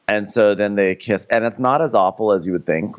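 ordinary talk

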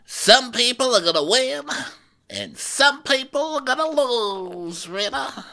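high, slow mock Erica; imitating Erica. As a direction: lean back, smug Erica. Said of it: "she's leaning back on the couch and she's like".

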